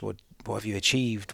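ordinary delivery

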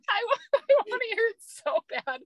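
giggling at her own cowardice; nervous laughter. Real, not acted. Telling the host she's too scared, laughing.

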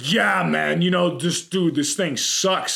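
lightly voiced big dude griping; a hint of gym-guy complaining. Imagined muscle man moaning in line, in a big guy voice. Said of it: "big, big guy voice".

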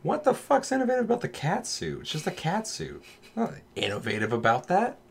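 high, scoffing disbelief; mock outrage at a cat suit; incredulous retort. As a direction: scoff at the idea, pitch up. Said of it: "I'm like".

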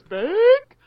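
"Bake." called out high and drawn out; Sue saying Bake. Retold or imitated. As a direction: announce "Bake." high, like the show host. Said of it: "When Sue says".

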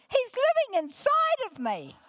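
very high, loud squeal of amazement; imitated squeal. Preacher imitates woman finding Jesus inside her, squealing. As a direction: re-enact her squealing at the top of her voice. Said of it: "she squeals at the top of her voice".

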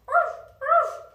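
high, loud yappy barks; dog barking. Acted. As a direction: bark like a little dog.

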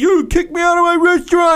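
very high, put-on outraged protest; mock indignant owner. Owner protesting to the TV expert, mock indignation. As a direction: squawk in disbelief, imitating the offended owner. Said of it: "He's like".

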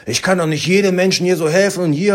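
high, loud mock rant; imitated angry outburst. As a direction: act out an exasperated shop worker losing it. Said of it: "ist voll ausgetickt".

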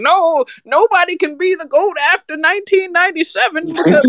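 high, mocking grumpy old-timer; mock crotchety old fan insisting. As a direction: sneer like a cranky old head. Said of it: "old and crotchety".

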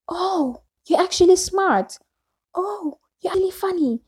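high-pitched imitation of condescending surprise; mock surprised compliment. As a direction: put on amazed, backhanded praise. Imitates people giving backhanded surprised compliments.

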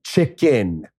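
slow, stretched-out condescending word; mock a rude tourist drawing out every syllable; like an asshole. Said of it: "like an asshole".

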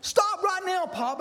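high-pitched mock yell of a frightened kid; retold scream. Granddaughter yelling at Pop chasing the dog, high mock scream. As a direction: pitch way up, calling a grandpa to stop. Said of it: "Lila screams at me".

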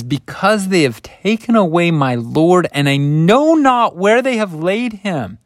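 high, loud, tearful panic; a grieving woman's desperate cry; panicked pleading. Retold or imitated. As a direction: plead through tears, voice climbing high. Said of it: "so much frazzlement and panic and pain".

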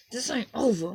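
dramatic TV threat. Pretend. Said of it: "start talking like Empire characters".

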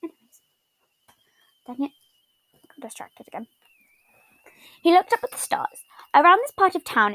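breathy whisper through hiccups. Real, not acted. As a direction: whisper to hold back hiccups. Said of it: "I have hiccups".

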